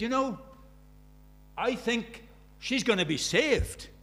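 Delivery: high, loud and slow with awe; hopeful excited teacher. Retold or imitated. Teacher on late-night phone call, high and hushed with awe. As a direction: marvel that she will be saved. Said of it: "He said".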